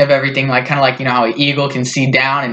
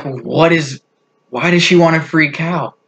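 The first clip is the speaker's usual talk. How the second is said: normal talking voice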